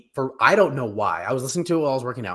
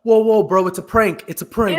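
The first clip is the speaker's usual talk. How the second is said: loud, high "whoa, bro, it's a prank"; panicky prankster; 7 semitones higher, 7 dB louder than usual. Pretend. Host mocks a prankster caught out, loud and high. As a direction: yelp it like a caught prankster begging off.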